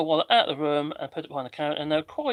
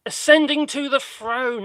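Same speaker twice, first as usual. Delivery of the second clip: grand announcer; 8 semitones higher, 7 dB louder, slower than usual. Pretend. Mock grand announcement of streaming.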